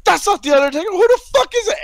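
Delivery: high, squealing mock outcry; retold screaming. Fan retelling seeing somebody come out, high mock screaming. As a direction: imitate a fan yelling in confusion. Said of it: "screaming off the top of my lungs".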